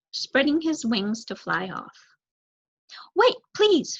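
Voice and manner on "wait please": a high call after the crow. Acted. Mouse calling to the crow to wait, calling out. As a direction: call out to stop him. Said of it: "she called".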